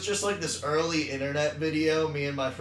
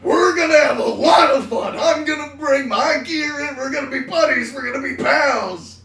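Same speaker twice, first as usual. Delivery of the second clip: high loud shouting; Farley yell; 10 semitones higher, 10 dB louder than usual. Retold or imitated. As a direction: holler it like a big guy. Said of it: "it's like macho".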